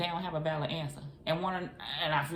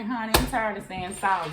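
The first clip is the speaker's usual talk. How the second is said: normal talking voice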